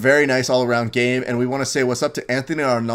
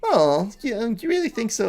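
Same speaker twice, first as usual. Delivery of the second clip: bashful surprised friend; 10 semitones higher, 3 dB quieter than usual. Retold or imitated. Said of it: "And he was like".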